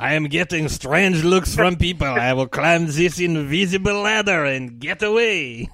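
mock French Canadian accent; Patrice Bergeron. Mime asking for help with rope, French Canadian accent. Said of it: "pitch perfect French Canadian accent".

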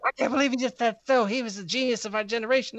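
hammed-up outrage from a grieving fan; imitating pissed girl. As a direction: play the offended fan, indignant and shrill. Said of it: "And she was so pissed".

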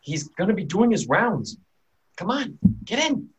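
high-pitched, urgent warning; panicked townsman. Acted. Panicked local hurrying strangers into the house, urgent and high. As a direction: urge them indoors in a panic. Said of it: "very panicked".

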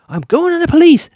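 high, loud over-the-top outburst; mock angry shout. Byron arguing with Ella at home, hammed-up angry shout. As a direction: bellow it high, imitating an irate father.

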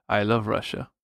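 put-on Russian accent. Co-host joking about loving Russia, Russian accent. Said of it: "That's a good Russian accent".

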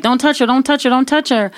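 urgent warding-off. Retold or imitated. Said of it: "fight my neighbors off".

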